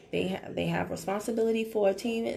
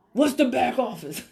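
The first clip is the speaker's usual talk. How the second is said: laughing mock question; 5 semitones higher, 7 dB louder than usual. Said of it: "member asks, then laughs".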